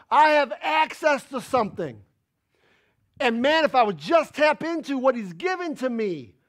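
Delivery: loud, high preacher cadence; preacher exhorting the church loudly; full-force preaching. Acted. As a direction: preach it out to the congregation.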